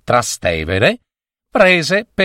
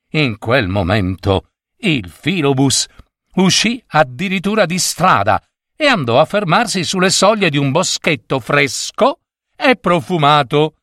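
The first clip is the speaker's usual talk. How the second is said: ordinary delivery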